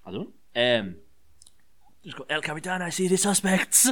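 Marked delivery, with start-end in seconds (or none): 2.3-3.9 camp up a phoney Spanish wrestler calling out; fake Spanish accent. Podcaster mocks wrestler's fake Spanish accent, hammed-up and high. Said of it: "totally unique and genuine Spanish accent".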